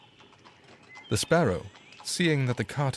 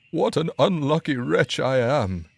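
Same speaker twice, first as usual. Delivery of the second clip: loud lament, a bit higher; crying out; 6 semitones higher, 5 dB louder than usual. A narrator's reading. Carter lamenting his emptied cask, loud crying out. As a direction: wail over the lost wine. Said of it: "cried he".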